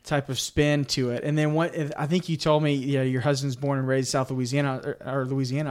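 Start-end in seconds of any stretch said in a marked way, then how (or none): none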